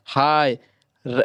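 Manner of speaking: normal talking voice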